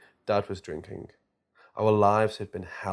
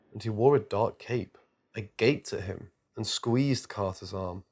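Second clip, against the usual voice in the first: ordinary delivery, nothing marked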